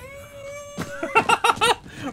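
high, loud cackle of victory; shrieking triumphant laugh. Real, not acted. Streamer cackling at Dougie boy's downfall, shrieking laugh.

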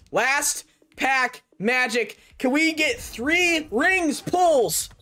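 slow, spaced-out words rising in pitch; slow suspenseful build. Real, not acted. Gamer hoping for magic in the last pack, slow suspenseful build. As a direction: draw out each word, building hope.

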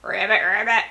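playful ribbit in a worn voice; lightly voiced frog noise. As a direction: croak out a mock frog call. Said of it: "I sound like a toad".

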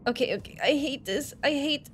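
ordinary talk, nothing marked

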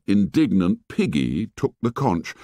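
plain reading voice

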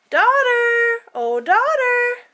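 high sing-song call, drawn out. Acted. Wife calling the daughter to help, high sing-song. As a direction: call her daughter over.

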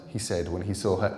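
plain reading voice

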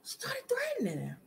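a hushed whisper repeating the line; whispered echo. Shown as a demonstration. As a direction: drop to a secretive whisper.

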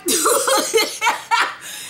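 high burst of laughter; real laugh. Real, not acted. Laugh heard.

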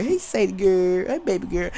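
soft dog talk. Real, not acted. Greeting his dog Sadie.

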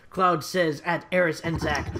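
plain reading voice

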